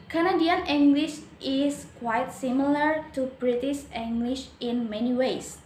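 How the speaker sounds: usual speaking voice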